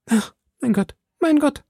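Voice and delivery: high, plaintive wail; distressed, high-voiced moan; anxious lament. A narrator's reading. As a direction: lament it, pitched far up. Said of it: "klagte Madame Bonacieux".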